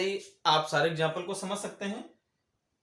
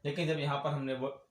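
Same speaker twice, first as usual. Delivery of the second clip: ordinary talk, nothing marked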